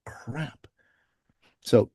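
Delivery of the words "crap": hushed, alarmed aside; a panicked whispered thought. Retold or imitated. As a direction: whisper it under your breath in alarm. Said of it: "And I'm like".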